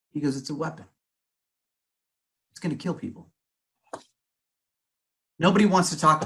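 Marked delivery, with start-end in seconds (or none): 0.4-3.3 soft, grave, almost under the breath; hushed warning. Retold or imitated. Electrician friend calling 5G towers a weapon, hushed. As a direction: say it like a dark secret.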